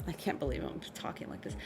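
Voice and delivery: breathless whisper to herself, fast; whispered aside. Real, not acted. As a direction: mutter it off to the side, embarrassed. Embarrassed aside after rapping lyric.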